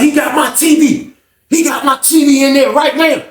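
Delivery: high-pitched indignant complaining; changed, outraged voice. Retold or imitated. Fonz complains about his T V, imitated in high outrage. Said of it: "His voice got different and everything".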